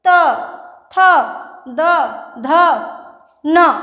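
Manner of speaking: त, थ, द, ध said with the tongue touching the teeth; slow dental letters. Shown as a demonstration. Teacher sounding out the dental row, slow and deliberate. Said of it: "दंत".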